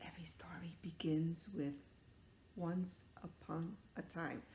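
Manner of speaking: hushed storyteller whisper. Acted. Teacher whispering the classic opening to kids.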